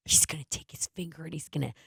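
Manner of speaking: low, quiet, conspiratorial narration to camera; hushed setup with a suppressed snicker; fake prank video. Prankster to camera before the chair trick, hushed fake prank video. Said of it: "like those fake prank things".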